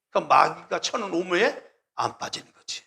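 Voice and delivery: normal talking voice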